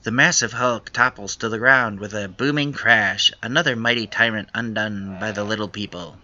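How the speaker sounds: story narration. Acted. Reads narration card.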